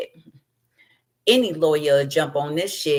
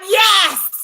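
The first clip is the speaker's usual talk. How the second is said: cheering yes; 8 dB louder than usual. Retold or imitated. Crowd cheering agreement.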